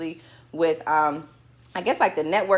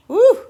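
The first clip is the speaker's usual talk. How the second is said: loud, high "woo" cry; excited woo; 12 dB louder than usual. Real, not acted. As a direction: let out a whoop. Excited outburst.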